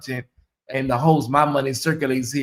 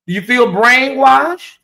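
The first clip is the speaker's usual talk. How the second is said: mock dramatic question; 7 semitones higher, 6 dB louder, slower than usual. Teasing viewers about donating.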